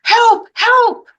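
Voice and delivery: high, loud cry for help; shouted help. Acted. Policeman radioing the firemen, loud high shout. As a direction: call help over the radio at full voice. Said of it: "he said".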